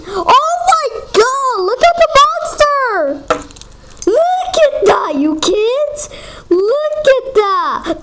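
high, drawn-out cry of alarm; alarmed excitement. Pretend. Player showing the kids the game monster, excited high shrieks.

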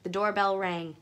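plain reading voice

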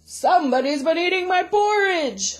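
loud, booming great big bear; Papa Bear voice. A narrator's reading. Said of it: "said Great Big Papa Bear".

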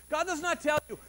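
usual speaking voice

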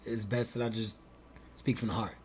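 soft, grave heartfelt confession; breaking bad news. Shown as a demonstration. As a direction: speak quietly and heavily from the heart. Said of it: "Watch, watch".

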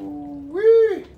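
thrilled squeal at the pot; excited ooh-wee. Real, not acted. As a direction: let it burst out over the food. Delight at the cooking pot.

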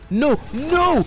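high, loud cry of alarm; sudden high panicked no; panicked yelp. Real, not acted. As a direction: yelp no as the hit lands. Character about to get punched.

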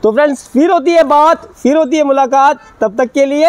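high-pitched presenter's farewell; vlog sign-off. Real, not acted. Host saying goodbye to friends watching, high-pitched sign-off. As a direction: lift the voice high for the closing catchphrase.

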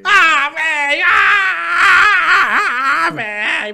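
loud, high wail of defeat; anguished yell. Real, not acted. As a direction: cry out as you die. Gets shot in game. (grito de desespero)

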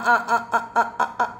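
playful chant of the long I; laughing vowel drill. Shown as a demonstration. Teacher to class, chanting the vowel, laughing. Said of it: "what vowel sound do you hear in my".

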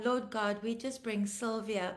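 ordinary talk

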